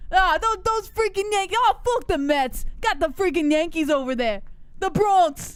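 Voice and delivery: blustering mock Bronx Yankee fan; Bronx accent. Co-host playing a Yankee fan, hammed-up Bronx accent. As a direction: rant like a caricature Bronx local. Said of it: "Bronx accent".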